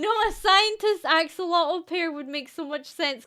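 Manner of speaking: ordinary talk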